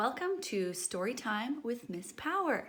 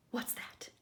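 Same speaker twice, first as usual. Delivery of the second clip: soft wondering whisper; listen carefully and whisper; hushed what's that; 8 dB quieter than usual. Acted. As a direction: pigeon whispering at a noise. Pigeon hears a bark.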